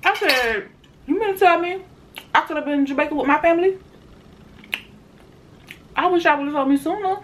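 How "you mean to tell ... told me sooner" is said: high, fast indignant protest; shocked and upset, words tumbling out; outraged disbelief. Retold or imitated. Retold indignant reply, high and fast.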